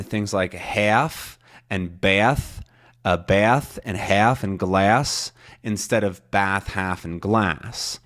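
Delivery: half, bath, glass slowly with a broad Boston ah. Shown as a demonstration. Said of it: "a different ah sound".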